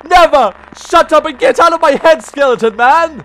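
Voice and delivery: loud high refusal into rapid scolding; defiant outburst. Real, not acted. Player refuses Skeleton Man's offer, defiant outburst. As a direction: shout back at the villain. Defying villain's threat.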